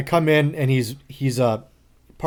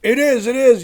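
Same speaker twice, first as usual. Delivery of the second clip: high, loud, frantic it is, it is; worked-up insistence; 11 semitones higher, 7 dB louder than usual. Retold or imitated. Coworker frantically insisting his CD tray opens itself. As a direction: insist like nobody believes you. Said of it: "He's all worked up".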